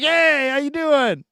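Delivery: imitating Gary's cheerful hello, slow and high; lightly sung-out friendly welcome; friendly greeting. Imitating G-Rad greeting fans, a high, slow cheerful hello. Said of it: "and he'd be like".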